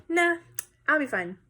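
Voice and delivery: casual mock Hamlet shrug-off; dismissive. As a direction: wave the warning away. Hamlet brushing off friends' warnings.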